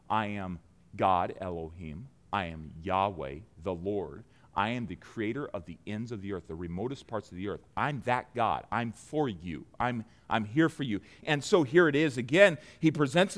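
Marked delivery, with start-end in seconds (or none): 0.1-11.0 much lower and softer, tender; hushed tender divine reassurance; soft voice of God. Acted. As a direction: speak God's words gently, close.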